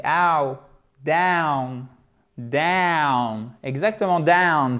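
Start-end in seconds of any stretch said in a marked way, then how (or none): 0.0-3.5 DOWN with a drawn-out "ow" glide; diphthong in DOWN. Shown as a demonstration. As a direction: stretch the "ow" in DOWN. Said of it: "Il y a une diphtongue".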